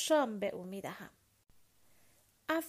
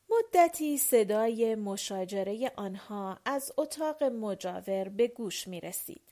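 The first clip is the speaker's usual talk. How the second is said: plain reading voice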